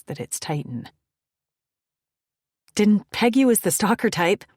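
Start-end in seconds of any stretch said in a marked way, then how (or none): 2.7-4.4 loud, higher teasing call. Acted. Woman calling back to the man following her, loud and teasing. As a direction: tease him over your shoulder. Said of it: "I call out".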